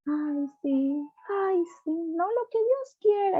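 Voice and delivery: high, slow, whiny mock pity; put-on pitying voice. Preacher mocking the pitying voice people put on. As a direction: mimic the high sugary voice of self-pity. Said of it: "eso ponen una voz".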